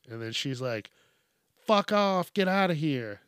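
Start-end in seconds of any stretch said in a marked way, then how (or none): 1.5-3.2 raised, louder mock-panicked shout; imitated panic. Imitating a woman shooing a sea lion, mildly panicked. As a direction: imitate a panicked shooing yell, only half-frantic. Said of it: "you can hear the panic in her voice".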